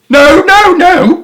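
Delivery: very high, loud pleading cry; begging the armed ducks not to shoot; high loud terrified plea; frightened cry. A narrator's reading. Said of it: "called out Mister and Missus Gregg".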